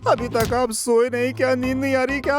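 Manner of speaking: high-pitched, timid small talk; frightened politeness. Acted. Man finding the ghost standing there, nervously polite and high. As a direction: squeak politely at what scares you.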